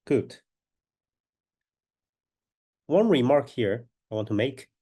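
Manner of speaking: ordinary talk, nothing marked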